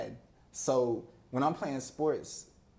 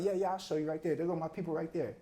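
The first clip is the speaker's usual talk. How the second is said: put-on higher, quicker kid voice pointing out his folks; eager younger self; 5 semitones higher, faster than usual. Boy proudly points friends to his parents, eager. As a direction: proudly show off the family as a kid. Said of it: "I'd be like".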